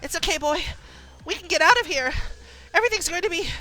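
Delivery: high, urgent comforting; anxious reassurance. Acted. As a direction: soothe a frightened horse while panicking yourself. Rider reassuring frightened horse.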